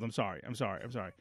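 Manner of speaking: quietly apologise, deflated; soft apology. Retold or imitated. Dad apologising to son after yelling, soft sheepish.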